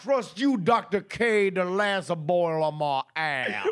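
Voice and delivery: put-on Southern accent. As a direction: read it as a hammy Southern doctor. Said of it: "simple Southern doctor".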